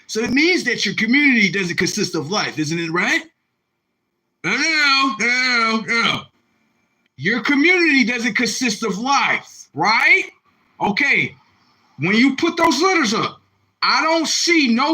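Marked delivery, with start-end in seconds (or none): none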